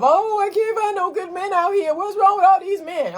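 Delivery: high-pitched mock lament; exaggerated, high-voiced griping; whiny single-woman complaint. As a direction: wail it like someone fed up with dating. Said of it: "keeping you in this mindset of".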